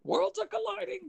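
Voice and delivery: very high, squeaky mock alarm; hammed-up panicked character. Sitcom catchphrase in a recap, squeaky mock panic. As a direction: cry it out in comic falsetto. George Costanza catchphrase parody.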